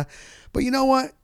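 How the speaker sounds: ordinary talk, nothing marked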